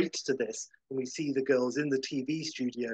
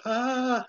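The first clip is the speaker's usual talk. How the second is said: very high startled yelp; startled duck yelp; 11 semitones higher, 4 dB louder than usual. Retold or imitated. Howard startled by the crazy bum, a very high yelp. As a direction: jump at the looming bum. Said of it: "Howard, uh, is like".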